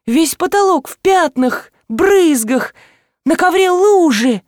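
high-pitched, alarmed listing; agitated insistence. A narrator's reading. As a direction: insist on what was seen. Woman insists blood was everywhere.

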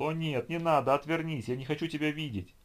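high, pleading terrified wail; wailing plea. A narrator's reading. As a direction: beg in terror. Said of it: "запричитал".

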